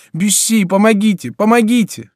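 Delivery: very high, loud cry for help. A narrator's reading. Diana calls Bussy to save her, desperate high cry. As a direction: plead desperately at the top of the voice. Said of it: "кричала Диана".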